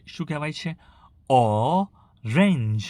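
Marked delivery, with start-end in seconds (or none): 1.3-2.8 very slow ઓરેન્જ split as 'awww... range'; sounded-out word. Shown as a demonstration. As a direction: stretch the opening 'aw' of ઓરેન્જ, then 'range'. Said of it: "અ પરથી તમે કહેશો આને". (ઓરેન્જ છૂટું પાડીને ધીમેથી, 'ઑ... રેન્જ')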